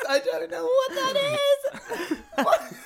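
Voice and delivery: high, loud wail through laughter; slow, squealing, hysterical disbelief; laughing wail. Real, not acted. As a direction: wail it helplessly while cracking up. Helpless giggles at unreadable phrase.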